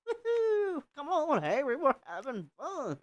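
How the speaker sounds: high, thin cheer; gleeful cheer. Acted. Kyle cheering at the arcade with Harry's body, gleeful. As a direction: whoop like a giddy kid.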